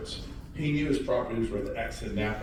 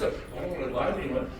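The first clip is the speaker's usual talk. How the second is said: ordinary talk, nothing marked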